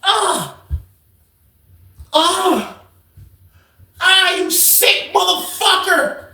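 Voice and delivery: very high, slow drawn-out shrieks of disgust; officer shrieking after being assaulted. Retold or imitated. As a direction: recoil in horror. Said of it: "she was like".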